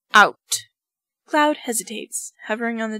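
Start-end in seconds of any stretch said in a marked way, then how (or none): 0.1-0.6 barked command of out; sharp loud order. Acted. General ordering his nervous cadet out, loud. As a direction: throw him out with one loud word.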